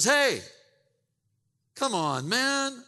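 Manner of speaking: a fed-up donkey squawking back at his rider; indignant donkey. Acted. Beaten donkey complaining to Balaam, high and loud.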